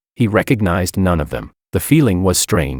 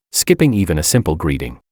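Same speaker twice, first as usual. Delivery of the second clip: usual speaking voice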